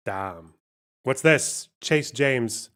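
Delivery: normal talking voice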